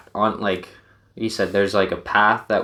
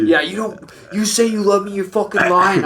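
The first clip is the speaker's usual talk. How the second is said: much higher, louder mock drunk accusing; drunk accusation; 12 semitones higher, 4 dB louder than usual. Drunk friend accusing buddy of lying, high loud anger. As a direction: hurl the accusation like a wounded drunk.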